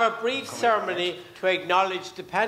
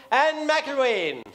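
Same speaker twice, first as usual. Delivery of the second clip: name drawn out, higher pitch; announcer's introduction; 4 semitones higher, slower than usual. Real, not acted. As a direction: call her onstage with a stretched-out name. MC calls guest onstage.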